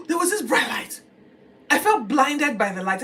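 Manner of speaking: high, loud, startled retelling; dramatic astonishment, pitched well up; awestruck alarm. Acted. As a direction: burst out as if dazzled. Reliving the blinding light.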